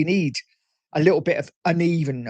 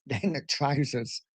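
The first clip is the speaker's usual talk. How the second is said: laughing whisper; 7 dB quieter, slower than usual. Acted. Reading out a funny chat message.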